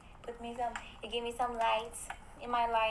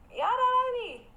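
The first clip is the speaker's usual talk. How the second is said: "Y'all don't love me" wailed much higher and louder; loud high whining protest at chat; wailing complaint; 14 semitones higher, 6 dB louder than usual. Real, not acted. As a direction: pitch the repeat up into a loud whine. Repeats complaint far higher.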